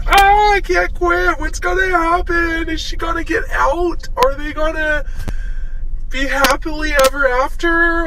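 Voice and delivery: high, overwrought wail of suspense; mock-frantic fretting. Hooked viewer of Handmaiden's Tale fretting over the ending, mock-frantic.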